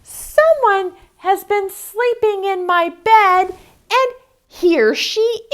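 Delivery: very high, loud squeak; squeaky cub. Acted. Baby Bear finding Aluki in her bed, high squeak. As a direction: squeak it like a tiny surprised cub. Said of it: "and squeaked".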